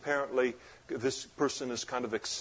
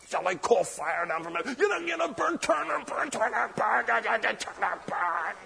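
high-pitched, hammed-up doom chant; mock fire-caller; 9 semitones higher than usual. Preacher mimicking disciples wanting to burn a village, hammed-up zealot voice. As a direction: send up a zealot gleefully calling down fire.

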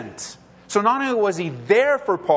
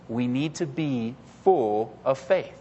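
normal talking voice

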